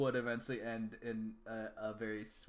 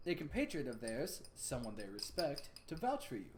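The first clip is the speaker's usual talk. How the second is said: measured, higher quest-giver voice; formal NPC voice; 6 semitones higher than usual. Acted. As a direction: speak like a courteous informant. Voicing an NPC in game.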